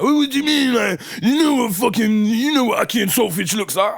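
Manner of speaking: high, loud, indignant imitation of a drunk mate; mock drunk protest. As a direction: bark it back like a tipsy friend who won't admit he's wrong. Drunk friend defending his food order.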